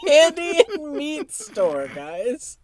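high, slow laughing repeat; helpless amused squeal; cracking up. Real, not acted. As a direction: squeeze it out through laughter. Cracks up at the friend's bit.

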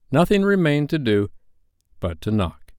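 usual speaking voice

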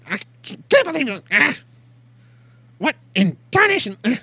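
high, loud frustrated yelling; frustrated old prospector yelling. Acted. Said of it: "not screaming, but very frustrated, uh, yelling".

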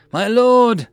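high, loud call; crying out. A narrator's reading. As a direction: call out urgently across a crowd. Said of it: "a voice cried out".

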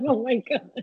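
giggling disbelief; laughing exasperation. Real, not acted. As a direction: laugh through "Oh my God". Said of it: "I'm like".

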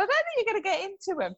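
high, quick imitation of a doubting husband; mildly mimicked doubter. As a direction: rattle it off high, like a retold warning. Retold husband's doubt, said higher.

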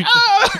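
high loud playful shriek; mock scream. Retelling Arthur scaring Gary, high mock shriek. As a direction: yelp like a startled clown.

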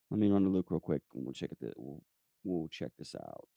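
very soft, low, half to yourself; low absent-minded murmur; talking to oneself. Real, not acted. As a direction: trail down while looking something up. Talking to self while flipping pages.

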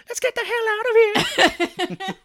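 very high, loud, slow cartoon yell; mock skeleton's squealed retreat call; panicking skeleton. One skeleton after the lost battle, high loud retreat yell. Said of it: "you hear one of them go like".

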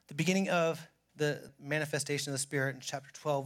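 normal talking voice

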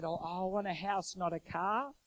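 lightly whining, rising at the end; mock whiny protest. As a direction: complain like a disappointed child. Protests the tiny bank offer.

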